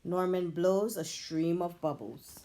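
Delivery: ordinary delivery, nothing marked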